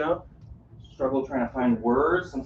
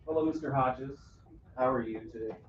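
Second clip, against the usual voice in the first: a polite greeting, said softer and lower; 4 semitones lower, 9 dB quieter than usual. Shown as a demonstration. Caregiver greeting an elderly man, politely and softly. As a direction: greet him gently and formally. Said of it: "Sometimes it's".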